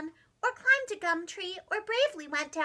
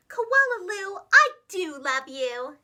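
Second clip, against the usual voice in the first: tender mother voice; 7 dB louder, slower than usual. Retold or imitated. Imagined mother's embrace.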